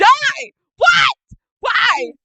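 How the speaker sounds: high, loud yelled bursts; acted screaming. Kira screaming at Hyden behind the door, high yelled bursts. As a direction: yell each word as its own outburst. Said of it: "you just hear screaming".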